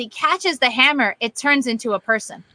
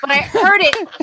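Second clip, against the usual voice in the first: raised, emphatic complaint; exasperated; 6 semitones higher, 7 dB louder than usual. Real, not acted. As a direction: pitch it up in disbelief.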